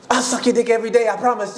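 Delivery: high, pleading fangirl rush; eager fan. Retold or imitated. Fan throwing herself at [name], high eager pleading. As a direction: beg him like an obsessed fan. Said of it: "She was like".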